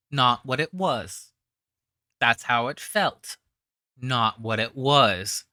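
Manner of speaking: "Not what it was" slow, every word leaned on; slow pointed emphasis. Shown as a demonstration. Host hammering the guest's phrasing, slow and pointed. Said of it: "Listen to the words she uses".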